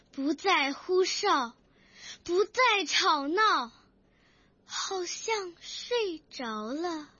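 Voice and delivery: exaggerated recitation voice; emotional poem recitation. Shown as a demonstration. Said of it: "路船就會為我哋示範佢嘅得獎作品啦".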